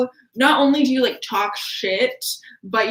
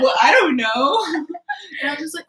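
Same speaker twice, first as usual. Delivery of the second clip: laughing; 7 semitones higher than usual. Real, not acted. Laughing while talking.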